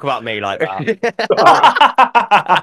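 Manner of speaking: slow, high, laughing fake offence; laughing mock protest. Friend pretends to be offended, laughing slowly.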